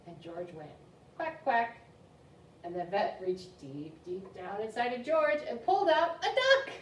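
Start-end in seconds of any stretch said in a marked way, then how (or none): 1.2-1.8 high-pitched quacks; duck quack. Acted. Puppy George at the vet, high quack. 2.6-6.7 building reveal. A narrator's reading. Librarian revealing the duck to kids, excited build.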